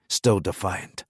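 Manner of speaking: ordinary delivery, nothing marked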